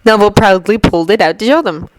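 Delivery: ordinary delivery, nothing marked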